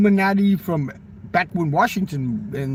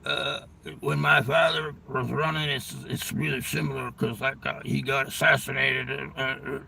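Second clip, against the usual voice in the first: halting, strained impression, soft and full of uhs; strained roid voice; 3 semitones lower, 6 dB quieter than usual. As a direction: imitate a croaky, labored talker. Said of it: "he talks like this".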